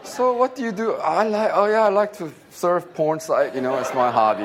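high, loud, hammed-up chitchat; mock small talk. Pastor jokes about porn as a hobby, mock small talk. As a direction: play it up as a silly high-pitched exchange.

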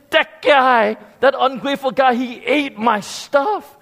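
put-on child complaining to mom about his brother; angry accusation.

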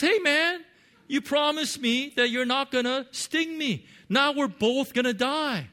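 the frog crying out, betrayed, as the sting hits; outraged frog. Acted. Preacher voicing the stung frog protesting to the scorpion, high and loud.

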